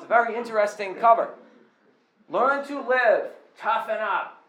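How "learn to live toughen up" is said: slow, higher Boston tough-guy read; Boston tough-guy accent. Retold or imitated. Pastor reading book cover in mock Boston accent. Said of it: "that's how we would say it in Boston".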